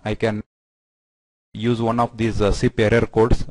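ordinary talk

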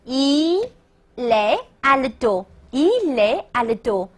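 "Il est" drawn out slowly as "i-lè"; linked reading. Shown as a demonstration. Teacher reminding students to link, slow "Il est Aldo" as "i-lè Aldo". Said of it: "注意连读哦".

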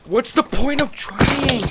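high, loud, slow cry; real drawn-out yell of despair; despairing wail. Real, not acted. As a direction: wail it out in exasperated defeat. Gives up amid attacking mobs.

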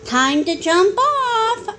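a hint of a sing-out announcement; lightly voiced bus driver. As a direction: call the kids off slowly. Bus driver calls to children.

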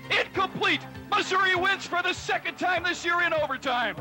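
high excited winning call; victory call. Real, not acted. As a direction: shout the win. Overtime win called live.

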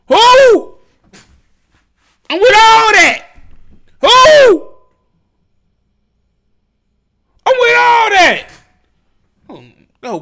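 high, loud yelled "who?"; outraged shouting. Real, not acted. Yelling at a rapper's threat, outraged and loud. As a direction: shout back at her, fired up.

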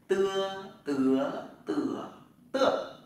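"tưa" said through four tones, loud, first one high; Chinese tone drill. Shown as a demonstration. Teacher drilling students on "tưa". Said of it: "Thử luôn này".

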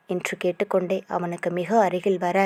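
usual speaking voice

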